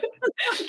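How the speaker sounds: sudden squealing laugh; high laugh bursting out; high burst of laughter. Real, not acted. Laughs at Wilfred name.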